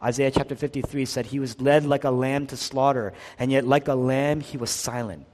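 ordinary delivery

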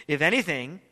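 usual speaking voice

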